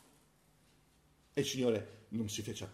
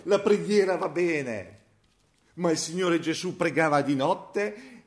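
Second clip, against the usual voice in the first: fervent preaching; 6 semitones higher, 7 dB louder than usual. Acted. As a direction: preach it out, building with fire. Preaching at full force. (predicazione accesa, alta e forte)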